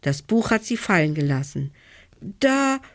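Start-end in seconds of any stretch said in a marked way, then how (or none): 2.4-2.8 put-on high, slow, shaky stuttering child; terrified girl stuttering at the terrace door; frightened stammer. A narrator's reading. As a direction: stammer it out in fear. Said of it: "stottert sie".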